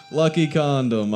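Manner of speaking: big restart of the punchline. Real, not acted. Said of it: "there it is".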